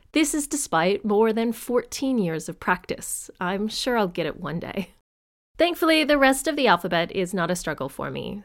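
ordinary delivery

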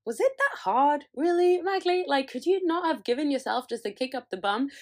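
scolding herself, high and loud; exasperated. Retold or imitated. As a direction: come on, really?. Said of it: "I'm like".

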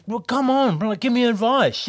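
hammed-up demanding voice; pushy caller. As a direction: push him like a pest who won't let go. Caller pestering, retold insistently.

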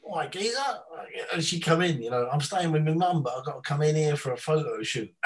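imitated Tottenham-supporter North London voice; North London accent. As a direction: do a chirpy North London football fan. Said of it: "real, like, North London Tottenham supporter".

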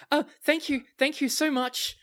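high, breathless gush of thanks; tearful grateful old lady. Acted. Old lady thanking a generous customer, high gushing thanks.

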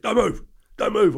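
loud, high don't move; mock police shout. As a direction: bark it like a copper.

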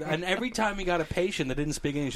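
ordinary talk, nothing marked

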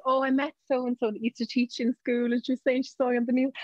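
high, put-upon celebrity moan; mock weary mum. Mum pretending the supermarket is a nightmare, put-upon. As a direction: complain as if fame is a burden.

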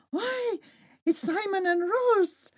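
high and slow, drawn out; delighted surprise. Acted. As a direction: recognise friends with delight. Martine recognises friends.

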